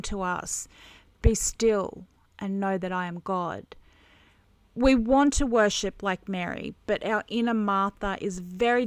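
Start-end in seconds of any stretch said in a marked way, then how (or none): none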